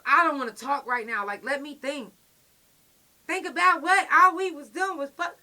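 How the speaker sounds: high, loud, fed-up pleading; loud frustrated argument. Retold or imitated. Yelling at her girlfriend to leave her alone. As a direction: shout her off, exasperated.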